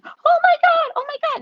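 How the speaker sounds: very high-pitched panicked shriek; hammed-up horror scream; mock screaming. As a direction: act out a crowd in panic. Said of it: "people were screaming".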